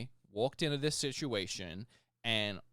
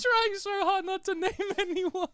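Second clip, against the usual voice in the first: very high, louder wail through laughter; laughing protest; 5 dB louder than usual. Real, not acted. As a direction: squeal it while cracking up. Cracking up after letting a name slip.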